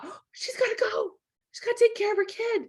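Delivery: high, quick mock alarm; startled realisation. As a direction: play the onlooker suddenly getting it. Voicing listener's instant thought.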